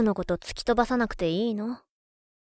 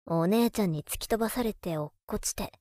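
ordinary talk

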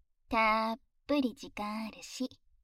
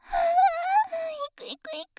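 crying out; 6 dB louder, much slower than usual. Acted. Climax in an intimate roleplay.

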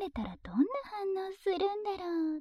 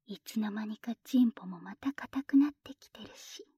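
whispering; 5 semitones lower than usual. Acted. Whispered teasing.

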